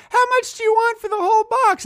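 put-on high squeaky little kid bargaining; a small eager kid asking the price, way up high. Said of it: "And I'd go".

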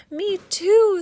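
high, loud little squeak; squeaky mouse. Acted. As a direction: squeak it like a tiny excited mouse. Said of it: "squeaked the little mouse".

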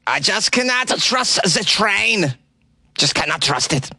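put-on slow, high German accent; mock German commuter, slow and high. Quoting Stefan the teacher in a German accent. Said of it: "I enjoy his German accent".

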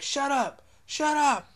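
loud, high mock yell; imitated angry man. Retelling the man silencing the lady, mock angry. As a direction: repeat it as a hammed-up angry shout. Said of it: "the food's just like".

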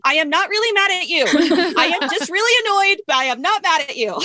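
high, tense mock-angry insistence; comic re-enactment of a strained, annoyed parent. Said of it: "my voice will be loud and raised and I'll be talking in a very pressured manner".

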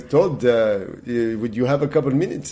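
put-on light Israeli accent of an old friend; Israeli accent imitation. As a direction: imitate your Israeli violinist friend, drawing out the opening. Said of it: "and he talked like this".

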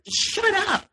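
high mock yell of a tense boyfriend; mock scream. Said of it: "just screams to everyone".

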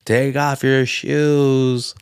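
very slow, drawn-out imitation; awkward Arkansas husband. Husband orders the visitor at the door, awkward drawl. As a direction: stretch it out awkwardly, as a retold husband. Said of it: "Like, all awkward. It's like Arkansas".

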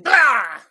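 high, loud comic yelp; mock cry of pain. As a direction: yelp as if just socked in the face. Acts out being punched.

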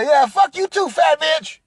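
high, heated comeback; angry retort. Retold or imitated. Man cursing his date back, acted anger.

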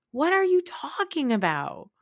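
high-pitched, baffled challenge; incredulous. Acted. Incredulous reply to Meredith's claim.

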